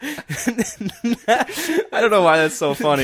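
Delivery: high, slow words through laughter; can barely talk for laughing. Real, not acted. Laughing fit over cheese sleeve.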